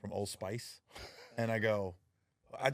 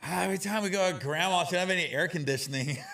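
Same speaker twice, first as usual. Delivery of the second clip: put-on higher, louder whiny kid voice; kids complaining; 8 semitones higher, 7 dB louder than usual. Kids complain about grandma's car, whiny. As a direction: whine like a sweaty kid in the back seat. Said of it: "my kids used to be like".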